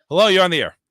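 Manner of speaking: on-air call-in host; radio host. Pretend. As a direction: greet the caller on air.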